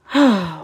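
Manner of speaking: heavy audible sigh. Real, not acted. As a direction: let out a long breath. Sighs after grumbling about youth.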